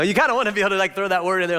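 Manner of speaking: ordinary talk, nothing marked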